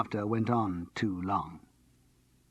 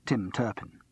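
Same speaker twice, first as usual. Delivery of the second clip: plain reading voice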